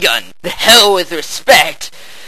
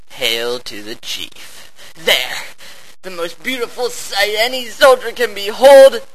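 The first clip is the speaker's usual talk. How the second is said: ordinary talk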